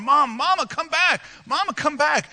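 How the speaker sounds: high, desperate pleading yell; crying, yelling child. Retold or imitated. Boy running after his mother's moving van, crying and yelling. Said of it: "he's crying, and he's yelling".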